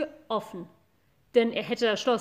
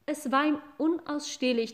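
plain reading voice

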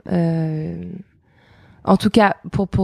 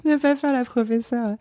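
laughing; 6 semitones higher than usual. Real, not acted. Laughs while saying it.

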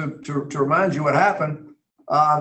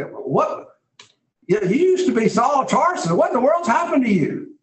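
astonished disbelief; 7 semitones higher than usual. Retold or imitated. Agrippa's astonishment at Paul's conversion.

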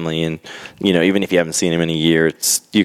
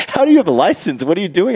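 high-pitched incredulous questioning; mock disbelief; 11 semitones higher, faster than usual. Licence staff challenge the young Australian driver, high-pitched disbelief. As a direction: imitate baffled officials, voice jumping high.

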